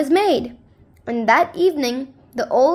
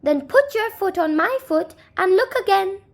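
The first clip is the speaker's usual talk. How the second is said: higher, kindly little man; lightly voiced small neighbor; little man offering; 7 semitones higher than usual. Little man invites the old man, higher pitched.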